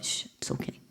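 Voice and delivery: whispered shush, gently calming; soothing hush. Pretend. Teacher comforting her own aging body, whispered hush. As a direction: hush it softly like a hurt child.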